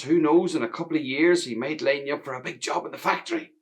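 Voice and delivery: gushing with pride over her son; delighted mother. Acted. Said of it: "my mother was delighted".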